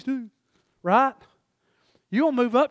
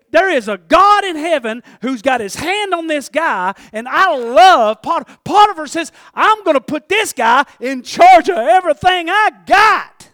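loud, high excited praise of the slave; amazed master; 5 semitones higher, 10 dB louder than usual. Pretend. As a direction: play the stunned master shouting his discovery. Potiphar voiced amazed.

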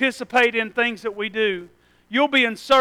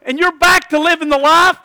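louder, higher preaching at full force; 5 semitones higher, 4 dB louder than usual. Acted. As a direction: drive the sermon home to the congregation.

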